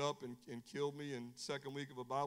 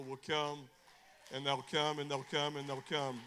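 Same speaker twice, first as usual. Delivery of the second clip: preaching; 8 dB louder than usual. Acted. Preacher repeating a rising refrain.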